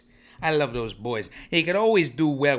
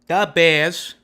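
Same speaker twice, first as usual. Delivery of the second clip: put-on loud, higher-pitched Chicago accent; 4 semitones higher, 9 dB louder than usual. Sports show host repeating the team name, loud Chicago accent. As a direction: punch it out in a big Chicago accent. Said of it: "That's my Chicago accent".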